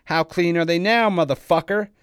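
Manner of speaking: high, very loud enraged shout; full-force mocking rage; furious taunt. A narrator's reading. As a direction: yell the question down at a beaten enemy. Enraged attacker taunting his victim.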